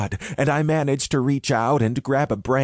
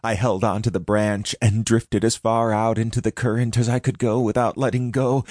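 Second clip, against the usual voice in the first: ordinary delivery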